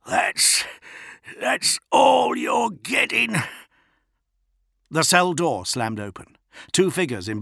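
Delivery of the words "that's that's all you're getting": defiant wheeze. A narrator's reading. Defiant tortured prisoner.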